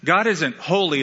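usual speaking voice

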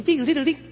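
high, loud ding sounds; magic chime. Pretend. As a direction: sing a magic genie chime.

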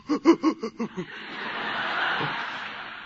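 high, breathy stuttering mock sobs; imitated child's sob. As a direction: sob in pulses like a spanked toddler. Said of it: "he lets out this sob".

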